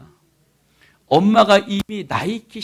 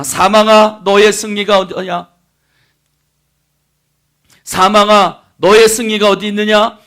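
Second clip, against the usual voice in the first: loud, ringing challenge to death; scripture proclamation; 6 semitones higher, 11 dB louder than usual. Real, not acted. Preacher reciting Corinthians to the congregation, triumphant proclamation. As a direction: declaim the verse with full force.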